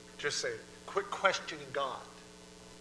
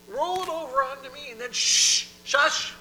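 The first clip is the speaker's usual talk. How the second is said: high, loud, firm command; insistent hushing; 10 semitones higher, 11 dB louder than usual. Acted. God quieting a worked-up believer, loud insistent hushing. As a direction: end on a forceful shush.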